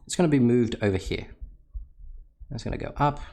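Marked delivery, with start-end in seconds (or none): 2.5-3.2 playful, pitched higher on up; voice goes up. Shown as a demonstration. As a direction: lift the voice like the graph rising. Acting out the graph moving.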